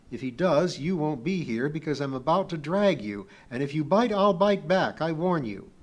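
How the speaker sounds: loud, emphatic threat; stern warning. A narrator's reading. Kip threatening to drag Pee-wee away, sternly. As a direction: warn forcefully, hitting the key words.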